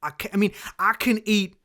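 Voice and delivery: hammed-up folksy accent, punched hard; put-on drawling accent. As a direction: complain loudly in a mock rural accent. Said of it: "doing the accent".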